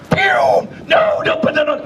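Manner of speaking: alarmed shout. Retold or imitated. Dogfish rushing to stop her.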